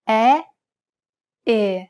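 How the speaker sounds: first E loud and wide, second E narrow; open E then closed E. Shown as a demonstration. Teacher repeats open and closed Italian E. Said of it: "Compare the open E followed by a closed E".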